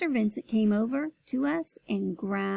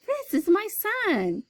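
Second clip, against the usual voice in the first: father protesting; 11 semitones higher, 3 dB louder, slower than usual. Acted.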